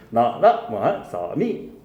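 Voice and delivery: 나라 말소미 chanted slowly in separate tones; slow tonal recitation of 나라 말소미; Middle Korean tones. Shown as a demonstration. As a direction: demonstrate 나라 말소미 with long, swooping syllables. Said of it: "이렇게 읽었다며".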